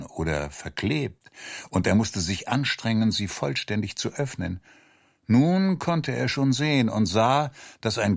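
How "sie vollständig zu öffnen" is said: ordinary delivery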